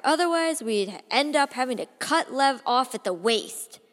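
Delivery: loud, biting mock praise; scornful toast. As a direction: hurl the sarcasm at the parents. Mocking toast to parents.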